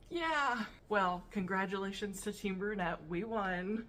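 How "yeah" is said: sarcastic. Retold or imitated. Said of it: "I was like".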